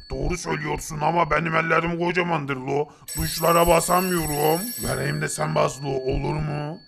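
dim giant Tepegöz. Acted. Tepegöz tells Nop he did not get the invention, in a low dull voice.

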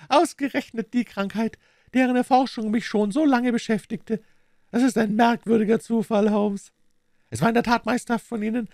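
high-pitched, smugly cruel voice; gloating villain Smith. A narrator's reading. Smith gloats over dying Holmes, smug high voice. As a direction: relish the victim's plight in a high voice.

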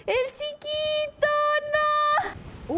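high-pitched, drawn-out mock lament; mock wail. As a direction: wail it slowly in playful dismay. Upset about killing the little NPC. (lamento agudo y fingido)

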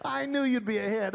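usual speaking voice

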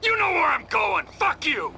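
enraged yelling at partner; furious shout. Acted. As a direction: scream it as you leave. Driving off in rage.

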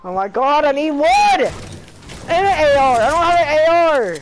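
yelling across the room. Real, not acted. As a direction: yell it to your squad. Begging teammates for gear mid-fight.

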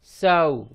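साऊ as s gliding from aa to oo; held glide on साऊ; blended syllable demo. Shown as a demonstration. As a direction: model साऊ for learners. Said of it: "तो बन गया".

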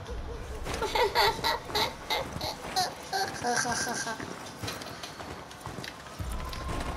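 soft unvoiced laugh; quiet airy laughter; breathy giggle. Real, not acted. Laughing at own mistake.

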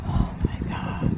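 whispered and slowed; awed whisper. Real, not acted. Seeing her cousin's headstone, awed whisper. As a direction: breathe it out in awe.

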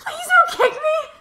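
shrieked high with a laugh; mock terrified plea. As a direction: squeal in fake terror.

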